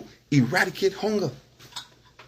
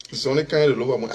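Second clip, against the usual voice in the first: fast, lightly voiced gossip quote; mock quote of gossip; 4 semitones lower, faster than usual. Mimicking people who say he chases money, mock tone. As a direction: rattle it off like idle talk. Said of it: "people say".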